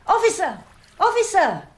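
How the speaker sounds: loud call for a policeman; calling for the officer. Acted. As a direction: shout across the docks for help.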